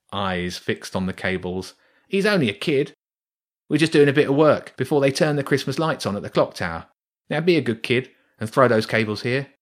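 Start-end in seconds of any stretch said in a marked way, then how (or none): 2.1-9.5 higher, fast wheedling rat voice; coaxing rat. Acted. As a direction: talk the kid into handing over. Rat coaxes the boy.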